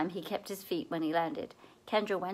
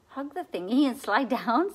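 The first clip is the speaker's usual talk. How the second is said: can't believe what he heard; incredulous repeat; 6 semitones higher, 6 dB louder than usual. A narrator's reading. Seth repeats Kendra's plan back, disbelieving. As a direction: echo it back in disbelief, rising. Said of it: "Seth asked".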